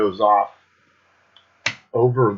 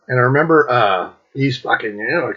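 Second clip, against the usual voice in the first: ordinary talk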